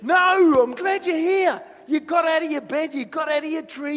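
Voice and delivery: high, loud exclamation; delighted shout. Acted. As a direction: burst out loudly, welcoming someone. Acted joyful answer to grumbling.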